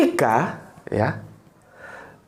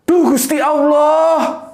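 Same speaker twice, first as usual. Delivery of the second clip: slow, drawn-out, higher startled cry; startled Javanese mother; 7 semitones higher, much slower than usual. Retold or imitated. Mother startled by her son's sudden homecoming, drawn-out cry. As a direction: gasp it out like a village mother caught off guard. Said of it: "Ekspresinya seperti itu".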